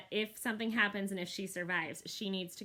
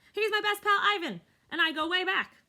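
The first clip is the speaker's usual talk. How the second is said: proud boast; 11 semitones higher, 8 dB louder than usual. Acted.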